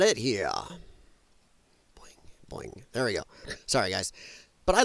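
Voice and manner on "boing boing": tiny whispered sound effect; playful boing under the breath. Pretend.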